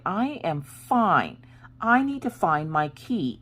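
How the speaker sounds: high, slow, drawn-out retold protest; exasperated insistence. Wife brushing off husband's worry, exasperated insistence. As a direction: stretch each word in mock exasperation.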